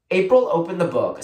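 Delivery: plain reading voice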